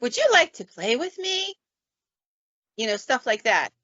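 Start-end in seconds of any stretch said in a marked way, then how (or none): 0.0-1.6 sing-song talking toy voice; imitated toy greeting, pitch leaping upward; Big Bird. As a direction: swoop up high like a children's toy. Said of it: "it tells you everything it's gonna say".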